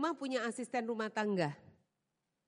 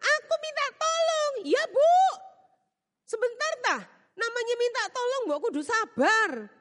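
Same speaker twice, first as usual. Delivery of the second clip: mock bickering; 12 semitones higher, 6 dB louder than usual. Employer and maid bickering.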